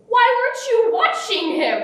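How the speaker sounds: high-pitched retold wifely reproach; mock scolding. Mom blaming dad for not watching, mock scolding. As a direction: play an exasperated mom telling off dad.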